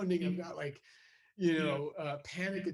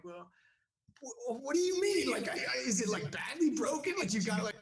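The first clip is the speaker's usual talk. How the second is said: much higher, worried questions; panicked; 9 semitones higher, 3 dB louder than usual. Retold or imitated. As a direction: voice climbing in alarm.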